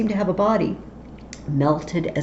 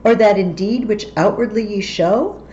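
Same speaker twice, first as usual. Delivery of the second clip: higher and louder, urgent questioning; Banquo challenging; 6 semitones higher, 4 dB louder than usual. Acted. Banquo challenges the witches, higher and louder. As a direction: demand an answer from the witches.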